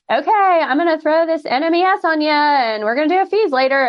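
mock clinician brushing past the patient's feelings; cold clinician. Shown as a demonstration. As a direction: rattle it off like a checklist. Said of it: "the difference between that and".

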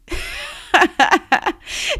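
squealing high-pitched giggle. Retold or imitated. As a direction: giggle like a mischievous girl. Girl giggles at her secret.

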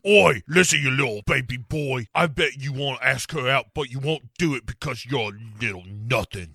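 brash cockney bully taunting; mocking tough-guy Hyde. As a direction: sneer it like a thug in the mirror. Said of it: "who's like".

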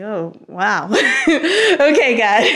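high, laughing "okay" of giving in; amused surrender to the scripture; laughing surprise. Retold or imitated. Reading the verse and laughing at God's answer. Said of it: "and I was like".

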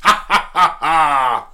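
gruff hearty voice lightly put on; Rip Torn impression. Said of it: "best Rip Torn impressions".

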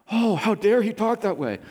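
sarcastic, put-on indignation; mock outrage. High priest faking indignation at Jesus, mockingly. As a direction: ham up the fake shock. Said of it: "On the inside".

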